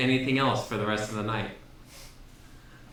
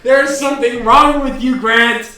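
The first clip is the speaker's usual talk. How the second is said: loud, high-pitched rant; heated arguing; 10 semitones higher, 13 dB louder than usual. Real, not acted. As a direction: argue back at full volume. Heated argument over popcorn.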